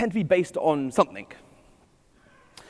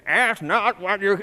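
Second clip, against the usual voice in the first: put-on a slow, tentative first try at JFK's Boston accent; 3 semitones higher, 4 dB louder, slower than usual. Speaker trying a Boston accent on a famous JFK line. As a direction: ease into a Boston accent like JFK, then stop. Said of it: "can I do the accent?".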